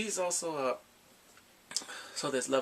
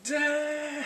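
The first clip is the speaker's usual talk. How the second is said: impressed damn; 11 semitones higher than usual. Retold or imitated. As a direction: react like the rescue blew you away. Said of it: "I was like".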